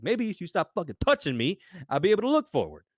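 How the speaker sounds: exasperated retort, high and loud; losing it. Retold or imitated. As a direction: act out snapping back at a shover. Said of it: "I lost my shit".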